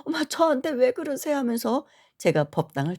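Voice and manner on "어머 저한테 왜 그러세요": frightened protest. Retold or imitated. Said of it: "너무 무서워가지고".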